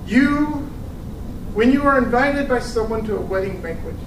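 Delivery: usual speaking voice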